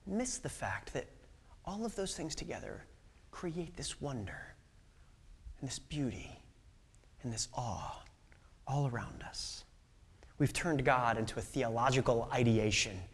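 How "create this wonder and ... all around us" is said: hushed awe. Real, not acted. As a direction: let it sink into a near whisper of wonder. Preacher drops to a hush.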